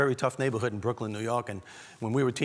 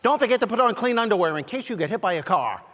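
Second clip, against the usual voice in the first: higher, louder mom warning; nagging mother; 9 semitones higher, 6 dB louder than usual. Retold or imitated. Mom warning son going outside, high insistent nagging. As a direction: nag like a mother at the door. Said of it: "And she would tell me".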